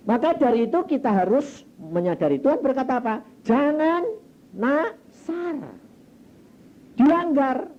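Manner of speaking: high, loud warning from the pulpit; emphatic preaching. Real, not acted. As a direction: proclaim it forcefully, pitch soaring. Preacher proclaims a warning at full force.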